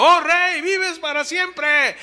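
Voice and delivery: high, slow call from below. Retold or imitated. Daniel calls up from the den to the king, high drawn-out call.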